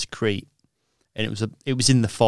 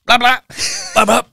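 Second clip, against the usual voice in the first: higher, louder mock [name] growl of nonsense; aggressive [name] sleep talk; 12 semitones higher, 6 dB louder than usual. Asleep, imagining himself sleep-talking, a hammed-up, louder, higher [name] rant. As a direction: bark gibberish like a half-hearted [name] track in your sleep. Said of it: "being in, like, aggressive D M X".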